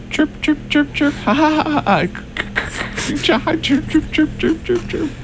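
high chirping bird imitation; bird chirps. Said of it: "I will be Laura and sound effects".

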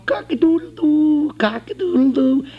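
high, far-off mock rooster crowing; rooster crow imitation. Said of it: "they're up in the morning going".

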